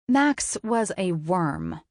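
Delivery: ordinary delivery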